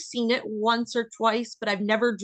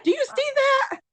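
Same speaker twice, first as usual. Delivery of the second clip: much higher, louder sightseeing squeal; mock gushing; 4 dB louder than usual. Friend joins teasing mom, squealing mock excitement. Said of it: "Are you looking".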